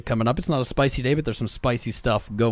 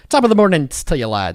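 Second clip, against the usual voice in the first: loud, high-pitched hammed-up Irish greeting; 5 semitones higher, 8 dB louder than usual. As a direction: put on a jokey stage-Irish accent. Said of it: "the Irish accent and things and stereotypes".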